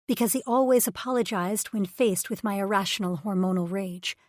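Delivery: usual speaking voice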